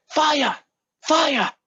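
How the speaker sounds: very high acted cry; high pretend warning shout; mock alarm call. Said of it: "the Polish guy goes".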